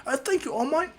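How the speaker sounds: high-pitched little thank-you; high voice, rising at the end; grateful fans. Acted. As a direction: pipe up like a fan thanking a hero.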